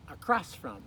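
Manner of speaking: "across" stressed on its second syllable, a-CROSS. Shown as a demonstration. Teacher has learners repeat, "across" stressed on "cross". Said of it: "accents on that second syllable".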